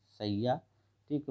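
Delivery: ordinary delivery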